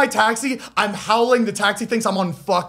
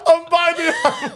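laughing; 9 semitones higher, slower than usual. Real, not acted. Laughing while retelling the text.

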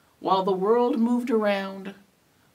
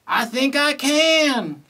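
excited acceptance; 6 semitones higher, 5 dB louder than usual. A narrator's reading. Kevin at last accepts the invitation.

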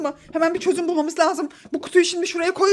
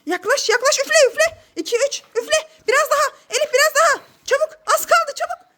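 excited urging; 6 semitones higher than usual. Acted. Urging the child to blow.

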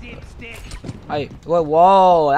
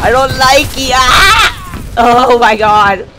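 loud, higher yells at the enemy; panicked yell; 7 semitones higher, 10 dB louder, slower than usual. Real, not acted. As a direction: shout in dismay as he keeps hitting you. Yelling at a mean enemy.

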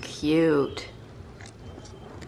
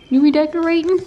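much higher and louder again on redecorating; louder repeat; 10 semitones higher, 9 dB louder, faster than usual. Real, not acted. Repeating the question to the cat, higher and louder. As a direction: call the question out loud.

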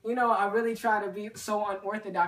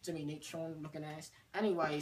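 put-on British accent; 4 semitones lower, 10 dB quieter than usual. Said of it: "with the accent".

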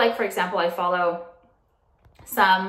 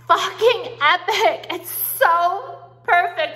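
high, slow, drawn-out gush; 7 semitones higher, 4 dB louder, much slower than usual. Real, not acted. Raving to viewers about her mirror, slow and high. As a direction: savour every word with delight.

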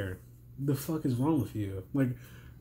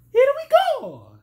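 much higher, loud Mario shout; 10 dB louder than usual. Retold or imitated. Tired commentator tries the Mario catchphrase, squeaky high shout. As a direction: belt it out like Mario taking off. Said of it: "I can't even do the Mario voice".

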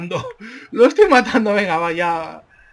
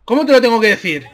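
fast, slightly raised outburst; exasperated quick question; 4 dB louder, faster than usual. Real, not acted. Gamer fed up with an opponent ignoring orders, exasperated quick question. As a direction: fire it off in one run.

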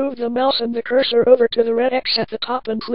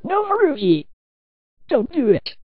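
high-pitched cry for mercy; high, desperate pleading; pleading yell; 6 semitones higher than usual. A narrator's reading. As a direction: plead with your killer, starting high. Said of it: "Carlos screamed and yelled out".

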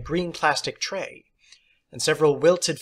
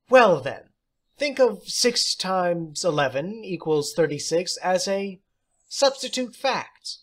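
theatrical teacher; 5 semitones higher than usual. Acted. Substitute teacher praising John, high and theatrical. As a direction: play the odd substitute, grand and pleased.

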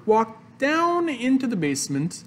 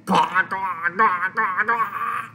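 mock gagging; slower than usual. A narrator's reading. Mother chokes on play clay, mock gagging. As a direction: run of throaty comic gags. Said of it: "And she yelled".

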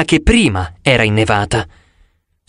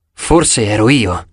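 plain reading voice

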